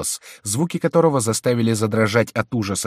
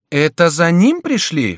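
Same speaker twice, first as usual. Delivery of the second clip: high, loud, drawn-out cry; exclaimed question; 9 semitones higher, 8 dB louder, slower than usual. A narrator's reading. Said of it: "вскричала герцогиня". (громкий тревожный вопрос)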